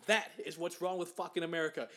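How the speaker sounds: usual speaking voice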